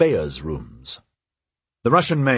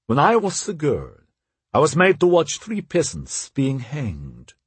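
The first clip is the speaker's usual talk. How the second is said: higher-pitched character voice for the wife; haughty Russian princess; 5 semitones higher than usual. A narrator's reading. Wife justifying harshness to her husband, haughty and unrepentant. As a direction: recount it as the unrepentant Russian mistress.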